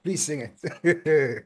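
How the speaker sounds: trying not to laugh. Real, not acted. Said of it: "Stop making me laugh now".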